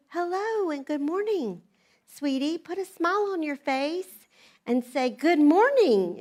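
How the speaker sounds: high, sweet sing-song voice; sugary greeting. Retold or imitated. Mom acts out the friendly church folks for her son, high and sugary. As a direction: lay on the church-lady sweetness.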